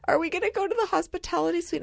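high, quick child imitation; anxious kid. Worried daughter asking mom in traffic, high imitated kid voice. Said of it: "starting to tear up and have panic".

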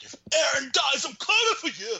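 hammed-up weeping menace; mock tearful threat. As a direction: sob out the vow of revenge. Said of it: "and he's like".

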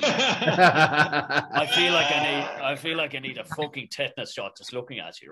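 posh South Dublin drawl, laughing through it; teasing an actor in a South Dublin accent, half laughing. Retold or imitated. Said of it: "turned Southsider, South Dublin".

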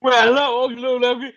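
high, loud mock Cajun greeting; hammed-up Cajun TV chef hello; Justin Wilson. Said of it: "he sounded like Justin Wilson".

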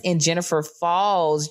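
ordinary talk, nothing marked

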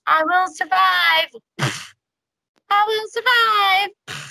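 high, drawn-out declaration of survival; mock survival cry. Host mimicking the trapped survivor's refrain, high drawn-out cry. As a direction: belt it out slowly, high-pitched.

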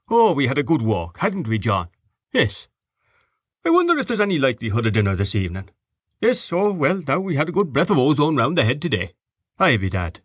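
a genial host just home from a walk; jovial father. Acted. Mr Dedalus back from walk.

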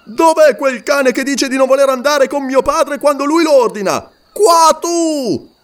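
grido rabbioso e molto più alto; furioso. Acted. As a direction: aggredisce il servo con rabbia. Warrior threatens reluctant porter. (Umslopogaas aggredisce il Wakwafi, furioso)

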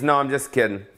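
ordinary talk

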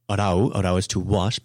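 ordinary delivery

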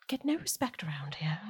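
breathless whisper of a sulky complaint; whispered grumble. Acted. Noble muttering after the silent guard leaves: whispered grumble. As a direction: grumble it under the breath, hurt pride.

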